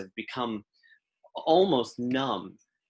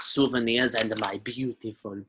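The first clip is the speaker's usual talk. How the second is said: put-on cranky old alien lady in broken Basic; old shopkeeper voice, grumbling; old Rhodian shopkeeper. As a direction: threaten then warm up, in character. Shopkeeper character voice.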